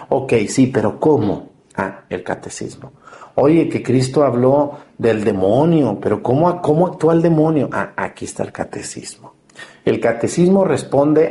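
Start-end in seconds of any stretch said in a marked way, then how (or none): 1.8-2.9 whispered aha, dropping the voice right down; quiet whispered answer to his own question; hushed aside. Acted. 7.7-9.3 whispered aha, dropping the voice right down; quiet whispered answer to his own question; hushed aside. Acted.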